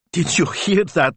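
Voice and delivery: high, drawn-out delighted squeal; thrilled, high-pitched outburst; excited squeal. A narrator's reading. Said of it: "to squeal".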